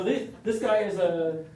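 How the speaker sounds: normal talking voice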